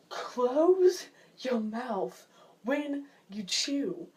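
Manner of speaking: very slow, drawn-out scolding; exasperated scolding. Retold or imitated. Telling a gum chewer off, slow and exasperated. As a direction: stretch out each word in mock exasperation.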